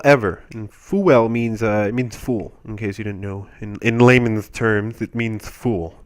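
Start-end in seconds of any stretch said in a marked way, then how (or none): none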